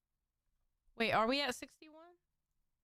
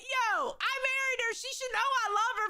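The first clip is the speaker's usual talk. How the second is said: hammed-up Dylan; 7 semitones higher than usual. Friend re-mocking Dylan for skipping "I love you", higher and hammed-up. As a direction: play the husband proudly defending himself, pushed higher. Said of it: "Dylan's like".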